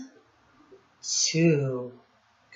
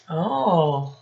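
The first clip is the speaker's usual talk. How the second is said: usual speaking voice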